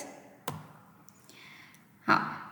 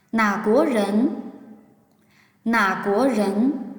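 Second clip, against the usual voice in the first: slow drill for students to repeat; slow teacher demo; slow model reading; slower than usual. Shown as a demonstration. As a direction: draw out 哪国人 syllable by syllable. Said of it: "跟我读，read with me".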